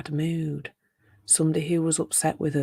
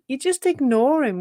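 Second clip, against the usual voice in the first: ordinary talk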